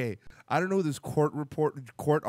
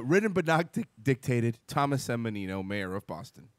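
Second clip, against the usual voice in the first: put-on Boston accent; 7 semitones lower than usual. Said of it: "read it like him with the Boston accent".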